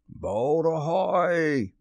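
high, very drawn-out call; Hook's ringing hail. A narrator's reading. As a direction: sing it out high and long across water. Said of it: "again came the voice".

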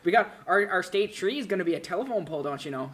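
hammed-up North Dakota accent. Local describing a treeless state, hammed-up North Dakota accent. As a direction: put on a mock rural North Dakotan accent. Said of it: "North Dakota has more of that".

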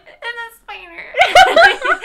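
high, loud, drawn-out wail; hammed-up panic, stretched and laughing; mock terrified wail. Student telling neighbour of the spider, mock terrified wail.

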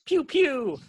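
high mouth-made pew pew; laser sound effect. Acted. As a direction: fire a toy ray gun. Laser fired in story.

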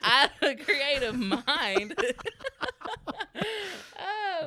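high, loud, talking through big laughs; cracked up by your own joke; laughing hard. Real, not acted. Speaker jokes with the host, laughing hard.

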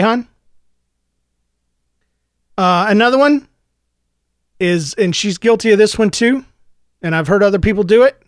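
ordinary talk, nothing marked

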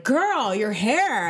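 put-on high, loud sassy "Girl"; sassy stylist. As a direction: gush like a hairstylist. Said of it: "They were like".

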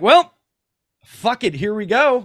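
high, loud mock bravado; hammed-up here-goes-nothing; reckless resolve. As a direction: throw caution away out loud. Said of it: "I'm like".

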